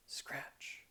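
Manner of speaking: breathy scratching noise; whispered scratch. Acted. Narrator imitating the mouse, a whispered scratch.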